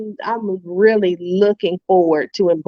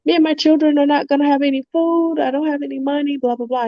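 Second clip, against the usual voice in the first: mock wailing; 7 semitones higher, faster than usual. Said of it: "she was like".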